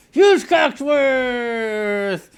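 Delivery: long stretched loud announcer call; host announcing himself drawn out. Acted. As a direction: boom out the name slowly. Announcer calling his own name.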